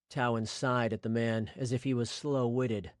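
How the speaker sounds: ordinary delivery, nothing marked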